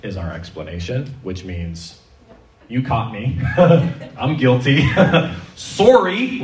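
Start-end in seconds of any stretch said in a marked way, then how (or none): 2.7-5.6 sheepish laughing admission. Acted. Acts caught non-listener, laughing. 5.6-6.4 high, rising Canadian apology; Canadian accent. Acted. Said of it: "we say in our best Canadian accent".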